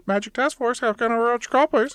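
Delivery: high-pitched put-on operator voice; switchboard operator. Operator answering for the Magic Task Force, high mock-professional.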